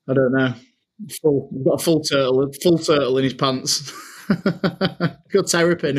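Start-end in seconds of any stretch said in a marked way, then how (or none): 4.1-6.0 laughing. Real, not acted. Laughing at own joke.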